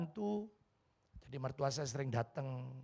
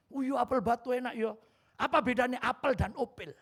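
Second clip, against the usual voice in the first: put-on higher, eager old woman; mother-in-law asking a riddle, higher and lively; voicing mother-in-law; 7 semitones higher, 4 dB louder than usual. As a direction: play the curious mother-in-law.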